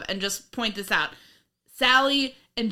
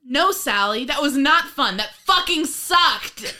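loud, higher, building angry rebuttal; exasperated mock fury; scary outburst; 6 semitones higher, 6 dB louder, slower than usual. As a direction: shout it down, dragging out the final words. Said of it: "You're scary".

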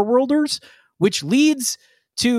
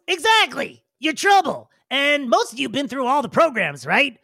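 higher, eager agreement; excited Vino; 7 semitones higher than usual. Acted. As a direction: jump on it eagerly. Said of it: "Vino goes".

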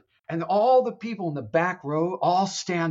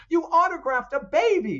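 high, louder scolding accusation; mock scolding; 4 dB louder than usual. Gabriel accusing Constantine, high mock scolding. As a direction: throw the accusation at him.

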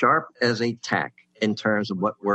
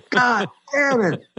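much higher, slow, drawn-out whiny curse; frustrated groan; 5 dB louder, slower than usual. Retold or imitated. Rival writer hearing he earns less, a high drawn-out groan. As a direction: stretch it into a pained whine.

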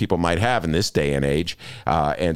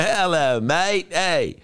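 high-pitched put-on Cockney accent; shout-out in a hammy Cockney twang; 12 semitones higher, much slower than usual. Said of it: "I was doing my Cockney, like [name]".

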